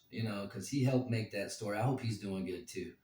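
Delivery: ordinary talk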